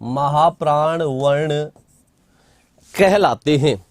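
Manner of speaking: slow, measured dictation with long pauses. Acted. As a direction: spell the definition out for students to write down. Dictating a definition. (धीमे-धीमे लिखवाते हुए)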